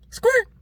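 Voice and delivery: short, very high squeak; tiny high-pitched screech; imitated scream. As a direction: yelp a quick, high mock scream. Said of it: "I was screaming like that".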